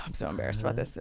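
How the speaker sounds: low, hushed, hurried confession; sigh, then rush it out under your breath; embarrassed aside. Real, not acted. Storyteller admits her outburst to hosts, embarrassed murmur.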